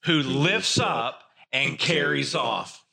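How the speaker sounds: who lifts up and carries off, slow and measured; slow unison phrase. Shown as a demonstration. Pastor leading the congregation in saying the phrase, slow. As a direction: lead the room through it slowly. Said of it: "say that phrase with me".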